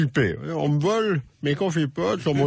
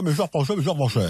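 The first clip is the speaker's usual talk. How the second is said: lightly laughing Giscard-style mes chers Français; mock presidential Giscard accent; a touch of Giscard's old greeting; faster than usual. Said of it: "J'ai même retrouvé mon accent de l'époque".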